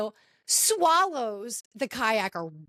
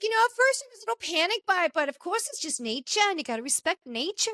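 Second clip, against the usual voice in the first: higher-pitched, quick New Zealand accent attempt; badly faked New Zealand accent for a calm kid; horrendous New Zealand accent; 7 semitones higher, faster than usual. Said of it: "a horrendous New Zealand accent".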